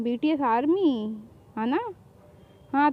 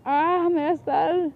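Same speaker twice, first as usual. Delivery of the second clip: pained groan; 2 semitones higher, much slower than usual. Acted. Hit on the head, groaning.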